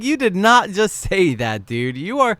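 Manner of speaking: high, loud imitation of a stunned reaction; mock disbelief. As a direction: voice someone who can't believe what he just heard. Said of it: "he's like".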